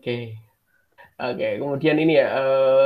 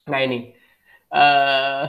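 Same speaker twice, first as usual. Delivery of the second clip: ordinary talk, nothing marked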